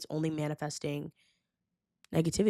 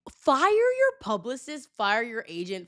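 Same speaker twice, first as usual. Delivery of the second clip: slow, much higher and louder; indignant rant; 5 semitones higher, 3 dB louder, slower than usual. Real, not acted. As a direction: demand it with outrage. Ranting at the couple's team.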